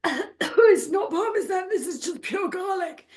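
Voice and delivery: high-pitched put-on complaint; mock choking protest. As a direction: protest high through the garlic. Acted complaint at garlic prank.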